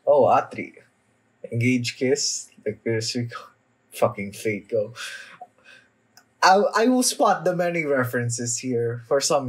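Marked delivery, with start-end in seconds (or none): none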